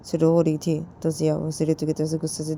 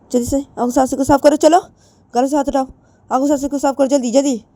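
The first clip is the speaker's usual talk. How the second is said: higher and louder angry scolding; furious threats; 7 semitones higher, 7 dB louder than usual. Acted. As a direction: threaten her, rising to a shout. Jiya threatens crying Ritu.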